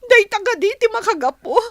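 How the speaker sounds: loud, broken laugh of hurt; loud hurt outburst; bitter accusation. Acted. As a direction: throw the blame at him. Accusing boyfriend of another woman.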